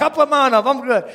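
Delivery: high, loud joking audience voice; mock volunteer. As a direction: imitate a listener piping up, amused. Mimics reluctant volunteer, while laughing.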